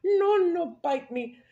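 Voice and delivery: mock-scared at a big scary-faced dog; mock fear. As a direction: squeal like you're scared of the dog. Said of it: "you're like".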